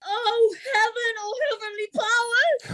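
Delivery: high, crying out to heaven in horror; a wail of betrayal; anguished cry. Acted. Emilia crying out as her husband is exposed.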